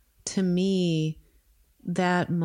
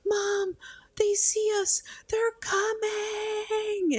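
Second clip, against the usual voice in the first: much higher, fading into a slow breathless whisper; panicked child; much slower than usual. Retold or imitated. Mother imitating daughter's frightened whisper, high and hushed. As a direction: squeak the warning, then whisper it slowly in terror. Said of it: "And she's like".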